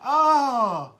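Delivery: overcome with emotion. Real, not acted. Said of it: "I'm about to get emotional here".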